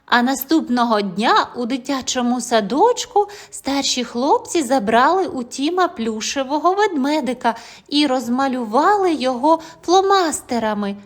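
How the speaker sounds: usual speaking voice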